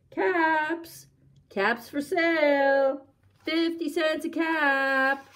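high, slow, sustained cry; street vendor's call. A narrator's reading. Peddler walking back to town, calling out caps. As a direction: call out wares in long held notes. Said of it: "he walked back to town calling".

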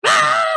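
high shout of fright; scared shout. Acted. Frightened snail yelps at Frog's calling. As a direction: yelp as if startled again. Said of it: "I'm sorry I was so scared".

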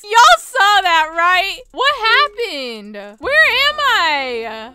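loud, high bewildered cries; gasp, then yell in disbelief with a laugh; startled confusion. Real, not acted. Player suddenly lost in the game, loudly bewildered.

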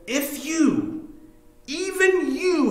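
high, drawn-out anguished cry. Acted. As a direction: cry out as if lamenting over a city, pitched far up. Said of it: "literally crying out over the city".

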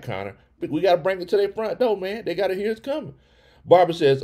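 ordinary talk, nothing marked

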